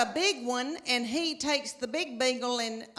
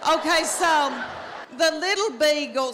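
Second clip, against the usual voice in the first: louder, higher, laughing; excited build-up; 4 semitones higher, 5 dB louder than usual. Acted. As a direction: pump it up for the punchline. Building to punchline.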